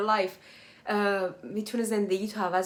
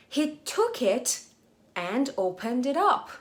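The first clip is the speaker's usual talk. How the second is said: slow reading; much slower than usual. Shown as a demonstration. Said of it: "slow English read-aloud for learners".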